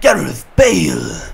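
drawn-out, booming announcer cry of the name; stadium announcer call. Pretend. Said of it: "Das wollte ich einfach nochmal sagen".

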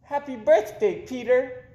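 put-on high girlish birthday greeting; little girl's happy birthday. A narrator's reading. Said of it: "said Amy".

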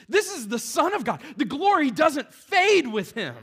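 high, loud, driving sermon voice; preaching at full force. Acted. Pastor driving home that Jesus is God's son, loud preaching. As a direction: build it to the pulpit climax.

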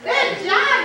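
high, thrilled exclamation; delighted. Real, not acted. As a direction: gush over the find. Delighted at child's find.

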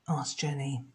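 ordinary delivery, nothing marked

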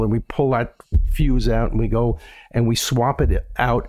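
ordinary talk, nothing marked

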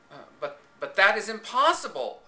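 loud, alarmed protest; panicked cry. Acted. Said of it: "I cried".

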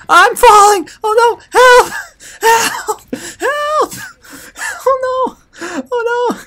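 very high screams, fading in volume; falling panic. Real, not acted. Player falling to death in game, shrieking panic. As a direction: shriek for help as you plummet.

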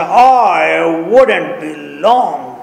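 very slow, stretched-out reply; drawn-out play reading. Acted. Maid answering the call for coffee, drawn-out play reading. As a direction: draw every word right out, theatrically.

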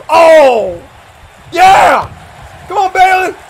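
real full-voiced fan shouting; too excited. Real, not acted. Commentator cheering on Billy, too excited to hold back. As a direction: yell loud and high, carried away. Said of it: "I'm too excited".

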